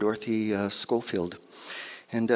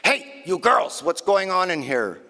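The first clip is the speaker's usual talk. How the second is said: scolding shout; 5 semitones higher, 4 dB louder than usual. Acted. Nun scolds the girls.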